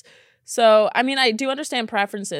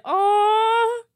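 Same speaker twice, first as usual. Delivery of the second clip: high crying aw at a sad story; 12 semitones higher, 5 dB louder than usual. Real, not acted. Reading a sad dating story to viewers, crying aw. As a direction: whimper aw, heartbroken.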